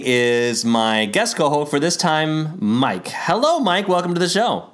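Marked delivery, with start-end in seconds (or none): 3.1-4.7 high sing-song hello. Acted. Host's awkward sing-song greeting.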